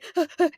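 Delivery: high, quick mock sobbing gasps; imitated hyperventilating. Student breaking down before counselor, imitated sobbing breaths. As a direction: act out gulping for air while crying. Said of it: "started hyperventilating".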